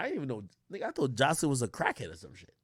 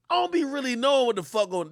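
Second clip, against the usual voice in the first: normal talking voice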